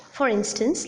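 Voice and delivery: ordinary talk, nothing marked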